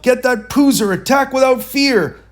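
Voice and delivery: high, loud battle cry; rally the troops to charge; yelled order. Acted. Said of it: "Then he yelled".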